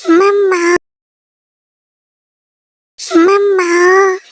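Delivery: drawn-out wailing call; calling out for mum. Acted. Daughter calls her mother on getting home, calling out. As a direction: stretch the word out in a childlike wail.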